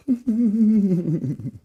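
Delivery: high laugh trailing off. Real, not acted. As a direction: chuckle at the mess. Laughs at smoky room.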